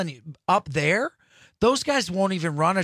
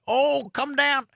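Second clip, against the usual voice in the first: put-on southern accent; 10 semitones higher, slower than usual. Southern friends inviting to hunt.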